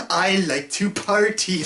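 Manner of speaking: slow, goofy stretched-out mimicry; Maxwell Lord. Reviewer mocks the clip just watched, slow goofy drawl. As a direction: draw the words out, mocking his goofy delivery. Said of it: "What the hell is this guy doing?".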